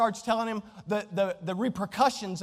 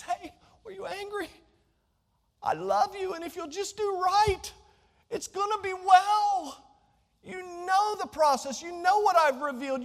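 higher, tender plea to Cain; higher voice of God reassuring Cain; loving God pleading; 10 semitones higher than usual. Acted. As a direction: reach out in love.

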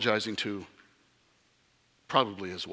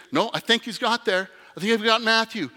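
excited angel; 8 semitones higher than usual. Acted.

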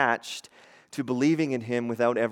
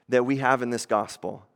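usual speaking voice

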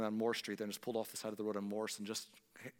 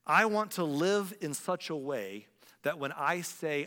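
slow, weighty, each word placed; deliberate quote of an old preacher; slow quoted Spurgeon; 3 semitones higher, 5 dB louder, slower than usual. Retold or imitated. Preacher quoting Spurgeon slowly and weightily. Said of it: "Spurgeon once said".

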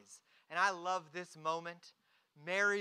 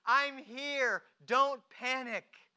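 louder, higher, drawn-out reassurance; emphatic reassurance; 5 semitones higher, 6 dB louder, slower than usual. Retold or imitated. Jesus calms Mary as she clings, emphatic reassurance. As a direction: reassure firmly without being rude. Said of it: "He's not being rude".

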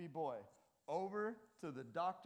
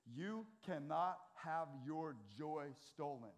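slow, every word weighted; emphatic repeat; slower than usual. Shown as a demonstration. Preacher repeating for drowsy listeners, slow emphatic stress. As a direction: lean on cannot and stolen, slowly. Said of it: "I'll say it one more time".

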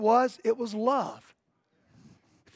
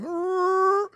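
high, grudging mock growl from a teenager; teen growl; 8 semitones higher than usual. Pastor imitating the teenage son's growl at a hug. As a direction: growl like a sulky teen being hugged.

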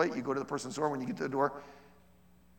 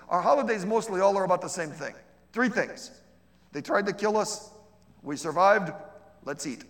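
comedian punchline; 5 semitones higher, 8 dB louder than usual. Retold or imitated.

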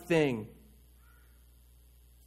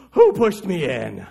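louder, slow, breathless complaint; winded indignant; 7 dB louder, slower than usual. Retold or imitated. Soaked husband after swimming past gators demands who pushed him, breathless and louder. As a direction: puff it out like a man who just swam for his life. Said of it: "he's gasping for breath, and he goes".

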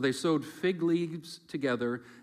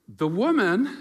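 blame shifting; 7 semitones higher, 8 dB louder than usual. Acted. Adam blaming his wife.

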